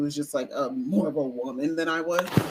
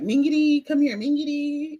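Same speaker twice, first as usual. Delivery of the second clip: higher sing-song teasing call of a nickname; 9 semitones higher, 5 dB louder than usual. Retold or imitated. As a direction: call the nickname like family teasing a skinny kid. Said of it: "they called me Mingiri".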